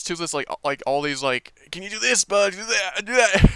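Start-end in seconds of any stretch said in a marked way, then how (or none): 1.7-3.5 higher, eager coaxing of a pet dragon, ending louder; coaxing Hiccup. Retold or imitated. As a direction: coax the dragon like a keen owner, rising to a push on the last "do that". Hiccup coaxing Toothless in retelling.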